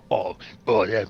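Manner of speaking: low put-on theater actor; Masterpiece Theater voice. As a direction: drop down into a TV drama character. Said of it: "everyone's talking like this".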